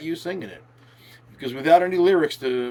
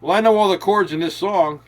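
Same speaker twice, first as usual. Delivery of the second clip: lightly voiced know-it-all, higher and louder; boastful student; 5 semitones higher, 5 dB louder than usual. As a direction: claim it proudly, pitched up. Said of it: "because anyone can say".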